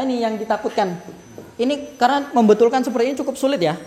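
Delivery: ordinary delivery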